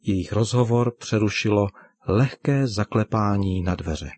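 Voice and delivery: ordinary delivery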